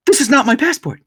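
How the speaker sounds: high, alarmed voice of the wife; wife starting to panic, pitched high; panicked wife. Retold or imitated. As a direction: sound the first jolt of panic over the wrong passport. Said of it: "all of a sudden she's like".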